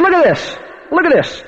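high-pitched mock threat; imitated belligerence. Angry man confronting the preacher in church, imitated belligerence.

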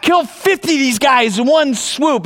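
a hammed-up eager fighter; cocky warrior. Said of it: "Probably thinking".

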